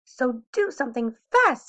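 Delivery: high, loud urging fish; urgent fish. A narrator's reading. Fish urges the children to act fast, loud. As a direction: hurry them along, fish voice. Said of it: "said the fish".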